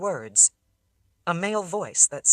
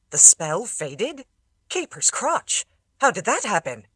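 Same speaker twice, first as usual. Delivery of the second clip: surprised; 4 semitones higher, 4 dB louder than usual. A narrator's reading. Ildikarans hear spell faded.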